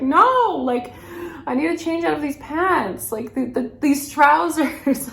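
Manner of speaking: high, loud flustered correction; retold loud protest. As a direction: protest too loudly, breaking into laughter. Retells own flustered protest in street.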